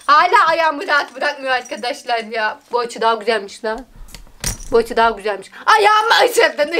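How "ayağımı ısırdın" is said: high, raised-voice protest at the cat; pained complaint. Real, not acted. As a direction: cry out at being bitten. Cat bites her foot.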